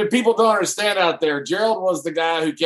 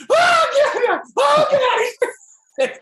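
very high imitated shriek; mock panicked hollering; frantic cry. Driver imitating his soaked passenger, frantic cry. Acts Dutch being peed on.

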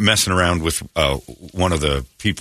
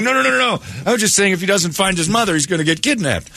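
high, panicky protesting; flustered lady over-explaining; flustered woman; 11 semitones higher than usual. Retold or imitated. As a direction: backpedal in a frantic high voice. Woman defending herself, story.

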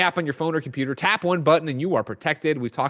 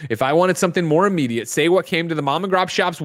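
ordinary talk

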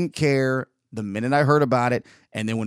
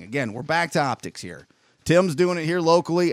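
ordinary talk

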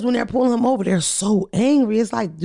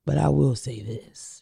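low and quiet; 8 semitones lower, 6 dB quieter than usual. Real, not acted. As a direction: drop the voice for a warning. Dropping voice for taboo statement.

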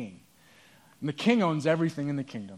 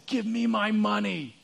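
higher, louder demand, building; angry demand; 6 semitones higher, 5 dB louder than usual. Retold or imitated. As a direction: bark it at him, shaking him. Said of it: "grabs him by the throat and says".

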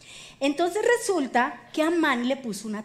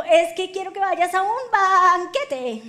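high, slow and loud, stretching the last word; hammed-up invitation to a king; playful coaxing queen; 6 semitones higher, 6 dB louder than usual. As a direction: draw it out high toward a big finish. Queen coaxes king, acted.

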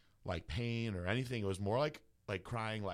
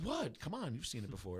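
high-pitched "what?", then easing down softer; feigned innocence; 4 semitones higher, 4 dB quieter than usual. Retold or imitated. Teller coaxing his embarrassed friend, mock-innocent. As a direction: play innocent, brushing off the fuss.

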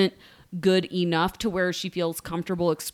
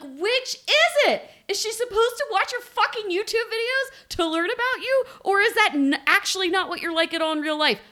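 high, incredulous and exasperated; exasperated high mock; 13 semitones higher than usual. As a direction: ask it in disbelief, pitched up. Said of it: "I'm like".

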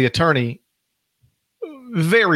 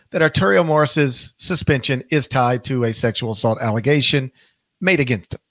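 ordinary talk

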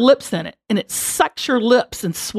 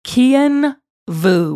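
ordinary talk, nothing marked

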